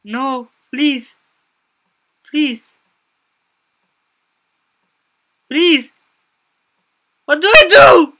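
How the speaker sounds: panicked pleading. Real, not acted. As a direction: beg for your life in full panic. Being attacked, pleading desperately.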